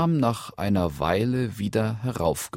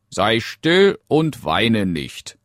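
slow, raised frog voice; reassuring frog; 5 semitones higher, 7 dB louder, slower than usual. Acted. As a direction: hush the princess slowly and firmly, as the frog. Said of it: "antwortete der Frosch". (beschwichtigender Frosch, langsam und lauter)

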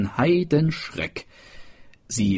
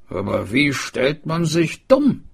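a higher, puzzled smith voice; smith asking; 6 semitones higher than usual. A narrator's reading. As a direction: ask it puzzled. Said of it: "fragte der Schmied".

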